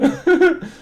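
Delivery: short high laugh burst; real laugh. Real, not acted. Vlogger laughing at his looks joke, short high laugh.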